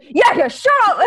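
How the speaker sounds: high, loud imitated angry shout; mad outburst. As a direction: snap like a calm friend finally losing it. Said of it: "get, like, mad at someone".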